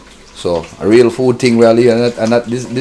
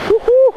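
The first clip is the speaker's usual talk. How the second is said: joyful whoop. Real, not acted. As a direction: whoop with delight. Whooping again.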